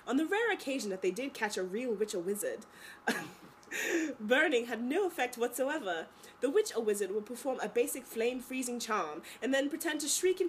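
put-on British reading voice; hammy British storybook narration; bad British accent. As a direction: read it in an enthusiastic amateur British accent. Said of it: "my really bad British accent".

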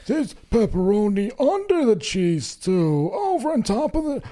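high, slow, ecstatic food awe; Double Rainbow guy impression. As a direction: moan it out in rapture. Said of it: "Imagine what he sounds like when he eats".

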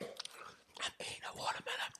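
hushed mock ASMR whisper. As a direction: breathe it like an ASMR clip. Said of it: "them A S M Rs".

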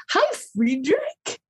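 high, slow, cooing hello to a dog; baby talk for the pet; sweet dog greeting. Retold or imitated. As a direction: coo at a beloved dog from afar.

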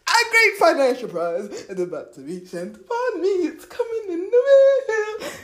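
giddy, rising into a high squeal; excited. Shown as a demonstration. As if a lottery check is coming, excited. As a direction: build to a high, thrilled pitch. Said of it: "Like, have that excitement".

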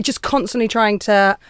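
ordinary talk, nothing marked

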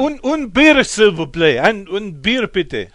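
loud, high put-on French and German; hammed-up tourist ordering beer; mock French accent. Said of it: "what do you got, Mark?".

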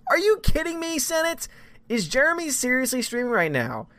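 high squeal of mock disbelief; surprised. Real, not acted. Host greeting a surprise viewer, squealing in mock disbelief. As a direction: shriek it in playful shock.

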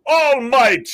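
high, booming heroic proclamation; big slow hero impression; All Might. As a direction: boom it out like a superhero, drawn out. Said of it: "I'm sure you've heard of My Hero Academia".

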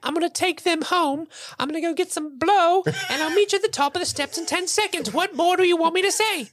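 high squeaky free elf; proud Dobby. Retold or imitated. Dobby boasting of his freedom in a high squeak.

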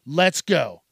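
usual speaking voice

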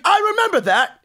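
high-pitched imitation of the tour manager's accent; Steve Wood's accent. Tour manager recalls the incident, high voice in his accent. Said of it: "in his accent".

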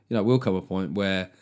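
normal talking voice

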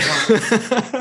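slow, laughing through the heat. Real, not acted. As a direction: laugh out at the spice. Tasting the spicy chicken.

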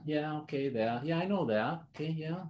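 yeah-yeah, already know it; mock nonchalance. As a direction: idly acknowledge familiar material, half-listening. Acting out his bored slide watching.

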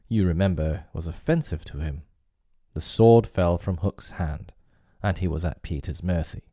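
usual speaking voice

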